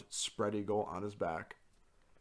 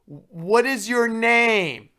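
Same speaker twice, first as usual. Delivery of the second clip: loud, high, very slow demand; imitating RA yelling slowly at drunk student; mock RA shout; 13 semitones higher, 13 dB louder, much slower than usual. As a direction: drag out each word like a patronizing shouted question.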